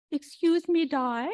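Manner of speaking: badly faked British accent, calling for attention; attempted British Queen. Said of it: "I don't, can't do a British accent".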